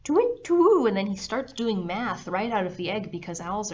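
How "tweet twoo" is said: put-on high, loud baby owl call; a baby owl calling brightly out of the egg; owlet hoot. A narrator's reading. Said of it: "baby owlet says".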